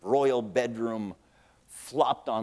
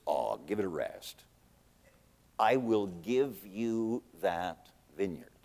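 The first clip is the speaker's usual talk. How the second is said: low, soft, scornful wife; dismissive queen; 5 semitones lower, 5 dB quieter than usual. Retold or imitated. Wicked wife scolding her sulking husband, low and scornful. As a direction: drop low and brush him off.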